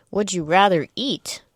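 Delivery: too loud. Real, not acted. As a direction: ask it a bit too loudly. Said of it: "I said that too loud".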